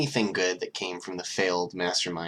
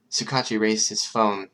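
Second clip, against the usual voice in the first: ordinary delivery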